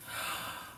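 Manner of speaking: quick breathless gasp of awe; soft gasp. Real, not acted. Seeing the silky fabric, soft gasp. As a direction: gasp at how nice it is.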